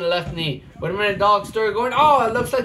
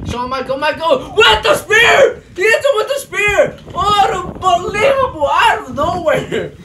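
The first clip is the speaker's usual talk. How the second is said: higher, louder wrestling-announcer yell; excited shout; 9 semitones higher, 8 dB louder than usual. Acted. Commentator calling a surprise spear, excited shouting. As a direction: scream the big move like the crowd's on its feet.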